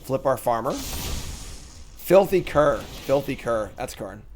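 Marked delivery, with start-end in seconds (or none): 0.7-2.8 slow, drawn-out card name; dramatic drawn-out announcement. Acted. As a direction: stretch "Filthy Cur" out slowly and grandly.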